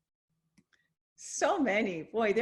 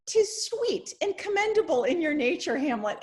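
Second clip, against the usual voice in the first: raised, louder mock royal pronouncement; a lightly voiced King Claudius, falsely sweet; the king's reply; 6 semitones higher, 4 dB louder than usual. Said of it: "this is the king's reply".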